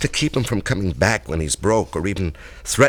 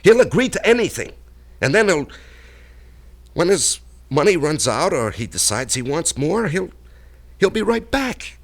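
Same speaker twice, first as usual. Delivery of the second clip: high, loud, bitter insistence; vehement; 8 semitones higher, 3 dB louder than usual. Acted. Man warns Allison the blackmailer will never stop, vehement. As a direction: hammer home that he'll be back.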